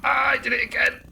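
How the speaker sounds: 2 high loud cry of self-annoyance; 3 shout at your own mistake; 1 exasperated yell. Real, not acted. 4 gamer repeating his mistake yells at himself, exasperated. Player messes up the same move.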